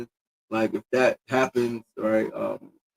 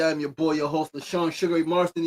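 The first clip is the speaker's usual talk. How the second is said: normal talking voice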